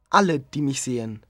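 plain reading voice

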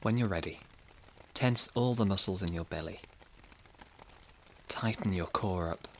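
plain reading voice

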